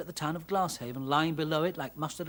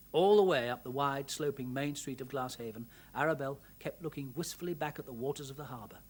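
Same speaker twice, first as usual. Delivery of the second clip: ordinary delivery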